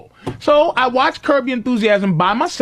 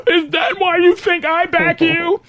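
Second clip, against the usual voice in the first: put-on hurt girlfriend; 7 semitones higher than usual. Girlfriend quoted, upset.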